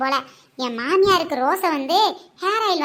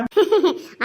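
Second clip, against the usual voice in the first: laughing. Real, not acted. Laughing at the sales pitch.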